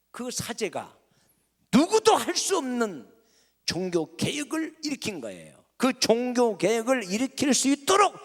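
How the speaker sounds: ordinary talk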